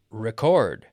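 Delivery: RECORD as re-CORD, slow and loud; RECORD as a verb, re-CORD; stress on second syllable. Shown as a demonstration. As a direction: stretch and punch the second half of RECORD. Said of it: "stress on the second half".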